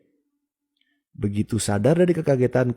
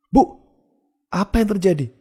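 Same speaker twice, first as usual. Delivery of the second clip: high, raised cry of alarm; alarmed shout; 10 semitones higher, 4 dB louder than usual. A narrator's reading. Child finds sister in bathtub, shouts at mother. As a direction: cry out in shock, pitch jumping up. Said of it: "tanyaku sambil teriak".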